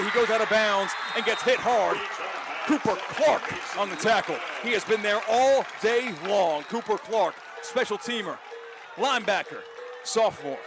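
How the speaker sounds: normal talking voice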